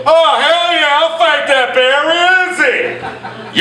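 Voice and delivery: put-on high, eager drunken bravado; drunk eager yell. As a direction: whoop like a keen drunk. Drunk eager patron answering.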